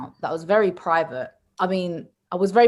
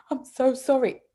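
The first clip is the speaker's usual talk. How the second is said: higher, imitated little girl's apology; mock sorry child; 4 semitones higher than usual. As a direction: plead forgiveness in a small child's voice. Daughter apologising on return.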